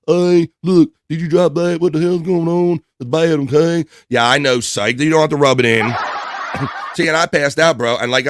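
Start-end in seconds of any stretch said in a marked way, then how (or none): 0.1-3.9 high, louder imitation of someone freaking out; mock freak-out. Host acting out a caller who dropped something, mock freak-out. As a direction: blurt it in a put-on panicked voice. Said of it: "he dropped something and freaked out".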